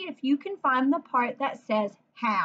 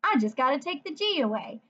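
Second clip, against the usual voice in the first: ordinary talk, nothing marked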